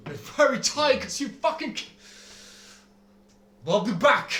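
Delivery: loud, raging parting yell; angry shout. Acted. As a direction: storm off yelling a threat.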